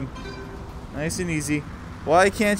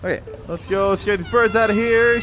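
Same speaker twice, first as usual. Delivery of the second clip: normal talking voice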